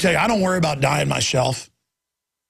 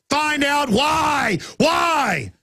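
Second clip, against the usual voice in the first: angry shout; 8 semitones higher, much slower than usual. Real, not acted. Angry outburst about cancer causes.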